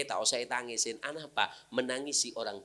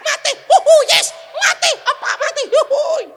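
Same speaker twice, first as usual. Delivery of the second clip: very high, loud hammed-up wailing with laughter; child mockingly wailing over mother's death; mock wailing child; 19 dB louder than usual. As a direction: wail like a silly crying kid.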